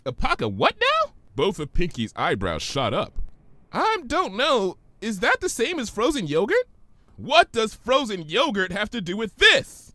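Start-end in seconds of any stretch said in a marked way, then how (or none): 0.1-1.1 much higher, bubbly pony; Pinky's voice. Acted. Pinky puzzled by Twilight's big word, high voice. 3.7-6.7 much higher, bubbly pony; Pinky's voice. Acted. Pinky puzzled by Twilight's big word, high voice. 7.2-9.9 higher, pushed Twilight outburst; exasperated shout. Acted. Twilight shouting at Pinky over yogurt, exasperated. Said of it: "Twilight shouted".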